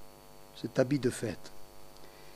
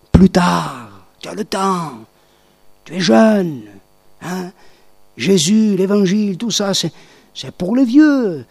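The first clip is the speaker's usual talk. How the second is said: tempting devil; 8 dB louder than usual. Retold or imitated. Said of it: "Ne laissez pas le diable vous dire".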